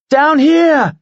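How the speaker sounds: high, loud call down to the ground; shout. Acted. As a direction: shout it over the rushing wind. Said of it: "she shouted".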